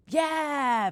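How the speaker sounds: put-on high, loud child's yell of delight; excited yell. A narrator's reading. Bella answering Mum about snow angels, loud excited yell. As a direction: shout yes with glee. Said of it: "Bella yelled".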